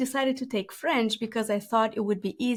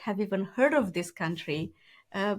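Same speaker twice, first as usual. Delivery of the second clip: ordinary talk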